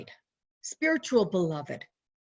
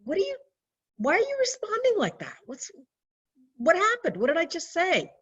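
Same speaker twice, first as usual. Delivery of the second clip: a lightly voiced baffled uncle, pitched up; confused uncle; 9 semitones higher, faster than usual. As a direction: play his puzzled surprise. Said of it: "he looked at me like".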